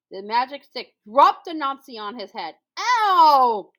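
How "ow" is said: loud ow; pained yelp. A narrator's reading. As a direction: yelp in pain. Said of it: "Anansi cried".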